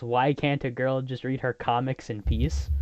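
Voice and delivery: ordinary delivery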